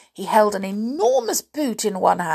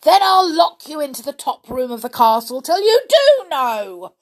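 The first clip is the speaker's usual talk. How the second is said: high, loud threat in a rage; raging storybook giant; enraged cry; 7 semitones higher, 9 dB louder than usual. A narrator's reading. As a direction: bellow it furiously, building on the threat. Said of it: "cried the giant in a rage".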